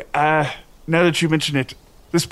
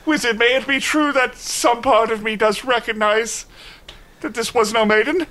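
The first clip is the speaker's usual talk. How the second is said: high, plaintive appeal; hammed-up anguished question; distressed knight; 9 semitones higher than usual. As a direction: plead with the wizard for the truth. Knight anxiously asks wizard for truth.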